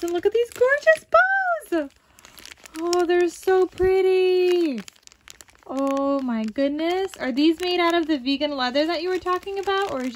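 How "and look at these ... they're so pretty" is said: excited gushing. Real, not acted. Gushing over gift bows.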